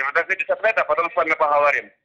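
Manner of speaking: usual speaking voice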